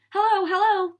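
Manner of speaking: high, loud urgent hello. A narrator's reading. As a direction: answer the phone with urgency. Firemen answering the telephone.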